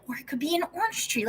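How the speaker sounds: eager suggestion, lightly imitated; retold excitement. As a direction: act out someone getting excited, without volume. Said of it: "he's getting, like, all excited".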